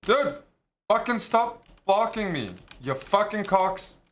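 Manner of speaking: furious yell, high and loud; angry shout. Real, not acted. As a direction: scream at the blockers, slow on the last. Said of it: "I'm gonna wake people up".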